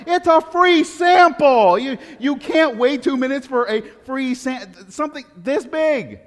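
exasperated disbelief. Retold or imitated. The pastor reacting to the shopper, exasperated and high. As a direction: marvel at her in mock outrage. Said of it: "I'm like".

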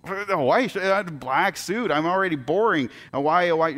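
high, incredulous acted complaint; mock dismay. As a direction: protest in disbelief, pitched well up. Retold dismay at God's choice.

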